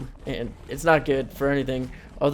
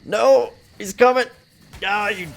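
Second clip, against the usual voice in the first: screaming much higher and louder; terrified scream; 10 semitones higher, 11 dB louder than usual. Real, not acted. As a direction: shriek as it charges. Creature charging at player.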